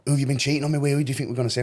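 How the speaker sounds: high-pitched imitation of an accusing girlfriend; mock the girlfriend's questioning, pitched well up; jealous accusation. Voicing girlfriend who suspects cheating, jealous accusation.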